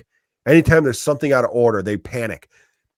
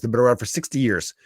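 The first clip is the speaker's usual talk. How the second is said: usual speaking voice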